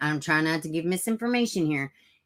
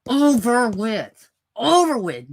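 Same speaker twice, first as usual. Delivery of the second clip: forceful threat; 4 semitones higher, 6 dB louder, slower than usual. Pretend. Threat of violence.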